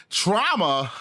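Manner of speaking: amused laugh at the word trauma. Real, not acted. Streamer laughing off chat's trauma comment, laughing.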